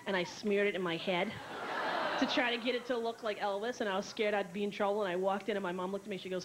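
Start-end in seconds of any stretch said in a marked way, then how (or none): none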